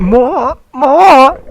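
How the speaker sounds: very high, very loud hammed-up yell; mock pained shout. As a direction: scream it out, overacting. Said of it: "I whispered painfully".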